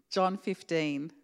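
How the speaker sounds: usual speaking voice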